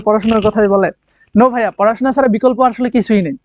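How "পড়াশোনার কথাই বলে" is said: a lightly mocking echo of "পড়াশোনার কথাই বলে"; mock grumble. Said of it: "তোমরা হয়তো মনে মনে বলতেছো যে".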